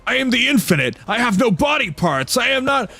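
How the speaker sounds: high, flustered protest; indignant being. Acted. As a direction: insist in a rising panic. Being protests while punched.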